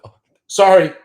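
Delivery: a sudden loud, high mock yelp of excitement; mock excited outburst. Trader blurting out hopes of a blow-off top, then a loud mock yelp. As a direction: burst out, then catch yourself. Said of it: "I didn't mean to say that".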